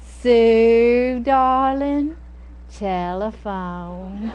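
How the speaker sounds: long-stretched Southern call to a daughter; slow, singsong Southern drawl; mother's Southern drawl. Retold or imitated. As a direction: imitate a Southern mom calling, every vowel drawn out. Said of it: "And then you would hear".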